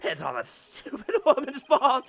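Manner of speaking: high, half laughing half crying; laughing breakdown. Acted. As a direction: crack up in despair. Villain's laughing breakdown confession.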